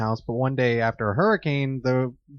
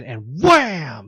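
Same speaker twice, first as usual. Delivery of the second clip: bang it out louder, like a fist on the ceiling; loud wham; 3 semitones higher, 6 dB louder than usual. Retold or imitated. Retelling the ceiling punch to friends, a loud mock bang. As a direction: slam the word.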